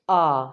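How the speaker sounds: आ held alone as "aah"; Spanish A vowel. Shown as a demonstration. Teacher answers how to pronounce it, isolated long आ. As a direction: sound out a long open आ. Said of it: "उसको कैसे pronounce करना है".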